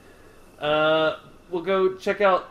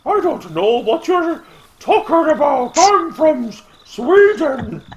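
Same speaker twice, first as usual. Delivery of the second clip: high, loud mock Swedish accent; 11 semitones higher, 8 dB louder than usual. Suspect dodging police questions, fake Swedish accent. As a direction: put on an exaggerated high-pitched Swedish accent. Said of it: "That's my Swedish accent".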